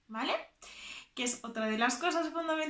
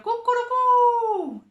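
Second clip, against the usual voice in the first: very high, loud, slow "cucurucú"; rooster crow; 11 semitones higher, 10 dB louder, much slower than usual. Shown as a demonstration. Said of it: "El gallo". (canto de gallo agudo)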